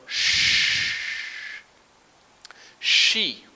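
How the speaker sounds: sh- hissed, then she high and loud; sh sound. Shown as a demonstration. Teacher reads the word list, hissed sh into she. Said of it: "Number one".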